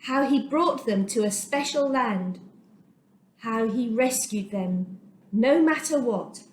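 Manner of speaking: ordinary delivery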